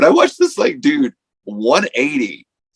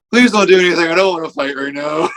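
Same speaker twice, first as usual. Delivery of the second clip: pleading; 7 semitones higher, faster than usual. Retold or imitated. Pleading to avoid the fight.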